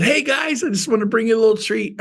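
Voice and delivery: high, quick, friendly pitch; cheery greeting. Retold or imitated. Agent brings pies to salespeople, retold cheery greeting. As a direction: mimic a chipper drop-in with a gift.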